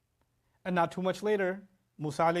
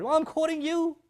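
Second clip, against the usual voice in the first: put-on high, raised kid's comeback; protesting little child; 11 semitones higher, 5 dB louder than usual. Little one answering scolding parent, high-pitched protest. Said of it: "one of my little ones comes over".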